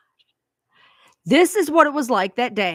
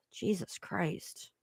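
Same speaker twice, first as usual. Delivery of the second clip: ordinary talk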